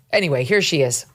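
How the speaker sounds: ordinary talk, nothing marked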